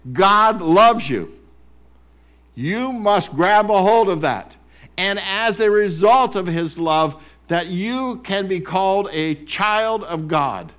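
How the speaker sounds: loud, slow, driving sermon delivery; booming pulpit emphasis; full-force preaching. Real, not acted. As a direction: preach it out to the room.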